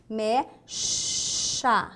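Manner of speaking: "mecha" as "mé", then a long hushed "shhha"; open E. Shown as a demonstration. Teacher sounding out the word, long SH. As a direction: stretch the sh in "mecha". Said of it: "que é o E aberto".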